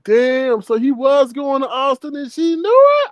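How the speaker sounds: high-pitched, hammed-up amazement; amazed disbelief. Host amazed Mama D knew about Austin, high disbelief. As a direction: pitch it way up, astonished.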